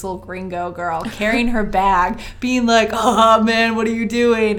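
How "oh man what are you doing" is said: loud, rowdy ribbing; teasing onlookers. Retold or imitated. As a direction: call out to tease a friend across the street. Onlookers tease man carrying her bag.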